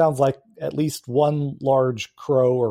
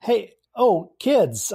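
higher-pitched, startled stammer; flustered homeowner; 7 semitones higher, slower than usual. Acted. Chris answers the door to unexpected kids, flustered. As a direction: play a man caught off guard at his door. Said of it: "He's like".

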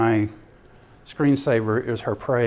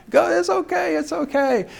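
very high voiced reassurance; welcoming reassurance. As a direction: wave the kids in with a high, easy reassurance. Jesus welcoming swarming children.

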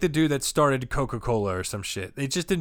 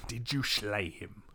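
slower, softer Scottish murmur; lightly voiced Sean Connery, lower and hushed; mock Sean Connery; 4 semitones lower, 9 dB quieter, slower than usual. Repeating friend's line, hushed Sean Connery accent. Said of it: "That's a little Sean Connery".

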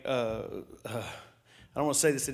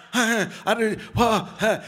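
higher, louder mock panting gasps; panicked gasping; 5 semitones higher, 5 dB louder than usual. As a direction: gasp like she's freaking out. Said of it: "Janae is literally freaking out".

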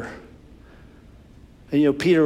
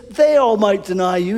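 boastful Peter; 6 semitones higher, 4 dB louder than usual. Acted.

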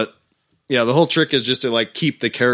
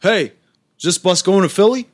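higher, louder New York guy; stranger asking; 8 semitones higher, 4 dB louder than usual. Retold or imitated. Guy at the bus stop asking about Philly, higher and louder. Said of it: "he's like".

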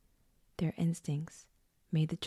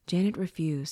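ordinary delivery

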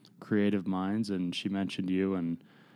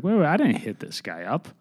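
surprised; 7 semitones higher, 4 dB louder than usual. Retold or imitated. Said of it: "and I was like".

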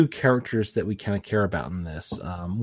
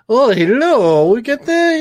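mock Spanish accent; 13 semitones higher, 9 dB louder than usual. Podcaster mocking an actress's over-the-top accent, hammed-up. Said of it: "kinda doing the".

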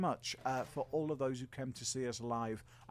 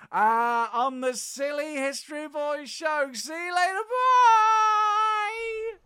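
rising, louder, ending in a high shout; big sign-off; 13 semitones higher, 10 dB louder, slower than usual. Real, not acted. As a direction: build to a big cheery bye. Sign-off calling out.

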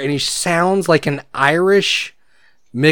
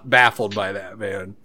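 usual speaking voice

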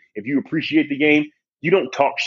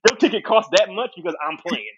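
high-pitched, incredulous brush-off; amused mock indignation; indignant retort; 7 semitones higher than usual. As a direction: scoff at a heckler in disbelief. Imagined retort to a heckler.

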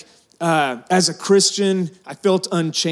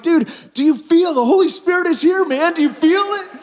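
laughing excited shout; 11 semitones higher than usual. Retold or imitated. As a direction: shout it through giggles, overflowing with joy. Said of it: "I'm just laughing. I'm like".

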